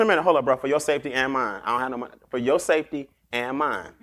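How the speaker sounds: warning a stranger. Retold or imitated. Telling a stranger on the street to back off, low and firm. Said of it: "'Cause I tell a person".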